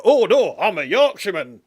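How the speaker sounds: loud, high, hammed-up Yorkshire accent. Mimicking an actor switching into his roots, booming Yorkshire accent. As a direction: burst suddenly into an exaggerated Yorkshire voice. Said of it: "he switches into the York".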